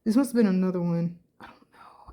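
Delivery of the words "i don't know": breathless whisper to herself; whispered "I don't know"; whispered aside. Real, not acted. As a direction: drop to a whisper. Aside under her breath.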